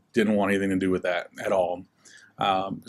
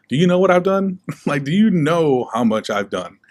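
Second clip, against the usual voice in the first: higher, louder disbelieving challenge; incredulous protest; 7 semitones higher, 6 dB louder than usual. Retold or imitated. Troubled teen answering the pastor's calling, incredulous. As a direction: protest in retold disbelief, pitch jumping up.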